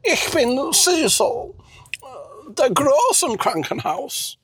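high, slow character voice; drawn-out German in a put-on high voice; Cecil, trying German. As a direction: declare it in laboured, high-pitched German. Plays Cecil speaking German.

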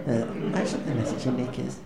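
ordinary talk

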